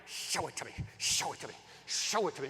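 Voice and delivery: low chant building from soft to normal; impatient inner voice. Retold or imitated. Husband's brain begging to see the design, low insistent repetition. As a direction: repeat it with growing craving. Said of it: "my brain is like".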